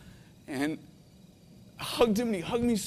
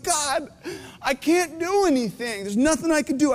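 higher, choked-up despair; fighting back real tears; near tears; 6 semitones higher than usual. Real, not acted. Father telling the church of his helplessness, near tears. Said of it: "I told you I was gonna cry".

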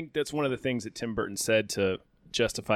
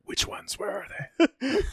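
whispered, menacing interrogation; imitating Batman; 12 semitones higher than usual. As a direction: hush it like a hammed-up vigilante. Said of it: "the way he says".

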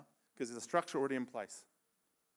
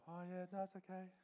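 high, small, quiet people-pleasing voice; meek and high-pitched, backing down from complaining; voice going up; 5 semitones higher, 12 dB quieter than usual. Retold or imitated. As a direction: squeak out a timid reassurance, softly. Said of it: "and my voice go up".

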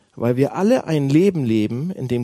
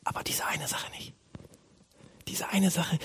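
hushed whisper, much softer; quiet aside; 3 semitones higher, 12 dB quieter than usual. Acted. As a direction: whisper it like a secret reservation in prayer. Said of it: "still hinterher sagen wir". (leise geflüsterter, heimlicher Nachsatz)

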